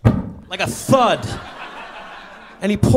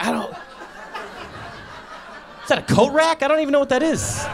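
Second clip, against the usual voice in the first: baffled; 8 semitones higher, 3 dB quieter, slower than usual. Retold or imitated. Baffled by the dildo.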